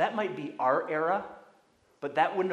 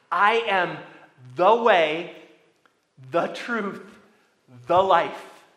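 each phrase louder and proclaimed with pauses; emphatic quote of Jesus; 9 dB louder than usual. Acted. Preacher proclaims Jesus' words to the congregation, loud and measured. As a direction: declare it like a pulpit proclamation. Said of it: "Jesus said".

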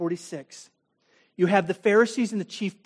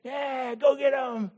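mild mock yell; 5 semitones higher, slower than usual. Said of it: "They're yelling on their way out".

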